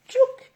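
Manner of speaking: little high-pitched click noise; high "tchouk" sound. Real, not acted. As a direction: squeak out a quick tool noise. Imitates the tool as she measures. (petit bruit aigu « tchouk »)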